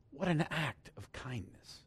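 soft whisper of wonder; awed whisper. Real, not acted. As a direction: marvel at it under your breath. Awed aside about God's kindness.

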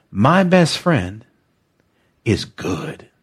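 slow, falling affirmation; slow call-and-response. Shown as a demonstration. Pastor leading listeners in a slow spoken affirmation. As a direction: say it slowly for them to repeat. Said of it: "Say this with me".

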